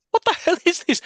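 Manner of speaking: shocked disbelief. Retold or imitated. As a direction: squeal it like you can't believe your eyes. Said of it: "I'm like".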